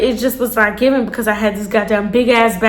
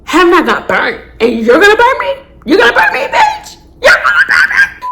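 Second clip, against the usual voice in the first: climbing from a raised voice to a squeaky shriek; outraged disbelief; 11 semitones higher than usual. Retold or imitated. Venting at the cheating ex, rising to a shriek. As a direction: build to a screech of disbelief. Outraged at ex who burnt her.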